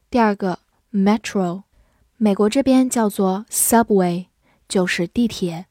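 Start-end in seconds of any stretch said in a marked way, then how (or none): none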